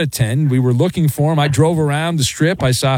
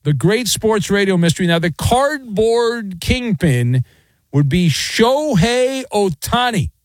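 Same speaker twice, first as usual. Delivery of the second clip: higher, slow radio teaser voice; dramatic tease; 7 semitones higher, slower than usual. Acted. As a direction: drag out the mystery like a promo.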